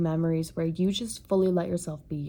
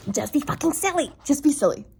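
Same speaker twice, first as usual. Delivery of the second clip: much higher, louder playful urging; excited urging; 8 semitones higher, 4 dB louder, faster than usual. Real, not acted. Urging viewers to be silly, excited and higher. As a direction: burst out with giddy encouragement. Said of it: "urging viewers to be silly".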